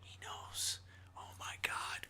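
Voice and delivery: unvoiced secret whisper. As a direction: whisper it like a hidden watcher. Voicing the watchers secretly.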